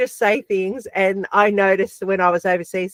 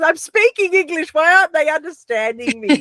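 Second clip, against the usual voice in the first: mock frustrated; 7 semitones higher than usual. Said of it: "she was getting so frustrated".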